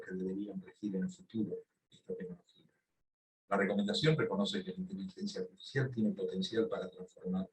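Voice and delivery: speaking quietly, under-projected; too quiet to hear. Real, not acted. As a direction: talk softly, as if to someone close by. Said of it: "le escuchamos bajito".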